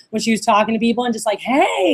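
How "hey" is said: high, drawn-out friendly "hey"; lively greeting. Retold or imitated. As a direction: greet them with a big swooping hey. Imitating lively employee greeting people.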